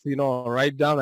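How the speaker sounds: ordinary talk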